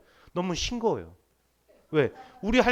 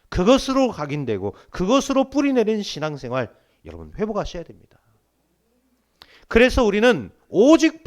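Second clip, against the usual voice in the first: loud, driving sermon cadence; preaching at full force; 5 semitones higher, 6 dB louder than usual. Acted. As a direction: hammer each phrase home to the congregation. Preaching the pure gospel.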